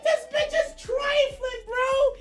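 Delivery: reading her screenshots, anguished comic yell; exasperated outcry. Retold or imitated. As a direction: wail it out in comic disbelief. Disbelief at her messages.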